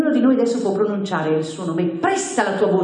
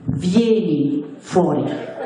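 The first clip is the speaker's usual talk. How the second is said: very slow, lower, measured call; slow solemn summons; 5 semitones lower, much slower than usual. Shown as a demonstration. Preacher models calling a buried cell member, slow and solemn. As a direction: spell out the call slowly and gravely.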